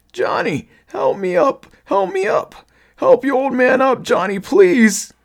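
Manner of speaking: high, loud, desperate begging; pleading. A narrator's reading. Crawling father-thing begs its son, pleading. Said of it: "he pleaded".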